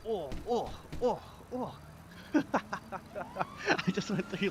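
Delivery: ordinary talk, nothing marked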